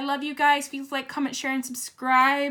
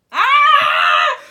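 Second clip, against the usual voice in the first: sudden high shriek of shock; real shocked scream; surprised scream; 17 dB louder than usual. Real, not acted. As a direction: scream at the card. Tarot card reveal shocks reader.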